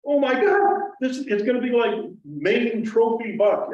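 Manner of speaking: high, loud, breathless rush of excitement; excited gasp. Retold or imitated. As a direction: relive the thrill of a huge buck coming. Retold excitement at approaching buck.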